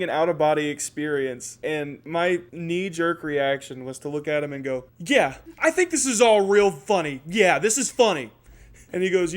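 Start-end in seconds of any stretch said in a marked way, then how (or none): 5.0-8.3 loud, high-pitched sarcastic outburst; defiant comeback. Retold or imitated. Kid snapping back at the cop, loud defiance. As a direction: throw it back loudly in his face.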